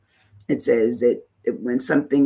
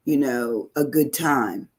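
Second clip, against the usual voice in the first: ordinary talk, nothing marked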